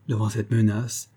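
plain reading voice

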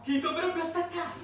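high, loud exasperated question; indignant outburst. Acted. Asking the audience who would attack, loud indignant question. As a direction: fire it at the audience in disbelief.

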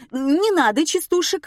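high, loud squeal. Acted. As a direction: shriek it out sharply. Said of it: "взвизгнула Лада". (визгливо, высоким голосом)

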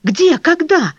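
urgent, high-pitched anxious questions. A narrator's reading. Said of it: "спросил он тревожно". (тревожно, взволнованно)

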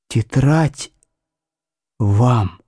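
hoarse and fading, dying man; hoarse weak voice. A narrator's reading. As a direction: rasp it out weakly, barely there. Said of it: "хрипло и ещё слабее отозвался Поляков".

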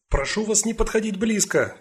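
high, commanding call to bystanders. Acted. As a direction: call the crowd back firmly. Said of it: "крикнула Сакс".

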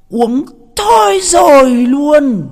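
very high, loud, slow shout; mock amazed shout. Describing the wife's drinking to his mates, stunned mock shout. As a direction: belt it out in disbelief.

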